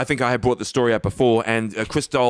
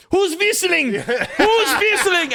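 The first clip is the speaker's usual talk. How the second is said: put-on high, stern German-accented whisper-yell; Christoph Waltz impression; much slower than usual. A German actor demanding who is whistling on set. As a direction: snap the question like an angry director. Waltz voiced in retold story.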